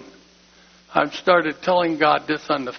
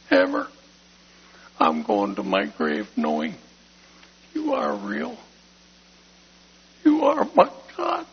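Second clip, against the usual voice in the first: emotional prayer; 5 semitones higher than usual. Retold or imitated. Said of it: "and I said".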